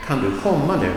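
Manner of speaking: quick "kan du", emphasized "komma", light "nu"; stress on komma. Shown as a demonstration. Teacher giving an example question, stressing main verb "komma". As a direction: run through "kan du" and lean on "komma". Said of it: "that is emphasized".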